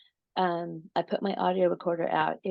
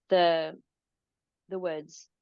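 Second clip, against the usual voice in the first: usual speaking voice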